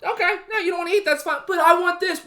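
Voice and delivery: mock kid whining. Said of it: "My mom just".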